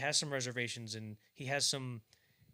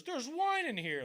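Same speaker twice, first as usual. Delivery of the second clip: high, slow, overblown gasp of wonder; hammed-up amazed reveal; 14 semitones higher, 5 dB louder, slower than usual. Guest in an overdone film version, hammed-up awe. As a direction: play the gawking guest at a cheesy miracle. Mocking a flashy miracle scene.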